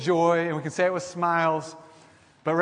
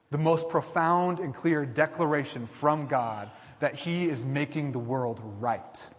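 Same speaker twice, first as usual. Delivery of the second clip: ordinary talk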